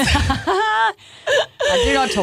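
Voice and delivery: high, drawn-out indignant denial through laughter; laughing protest. Real, not acted. Co-host objecting to being mimicked, laughing protest. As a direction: protest the teasing, pitched right up.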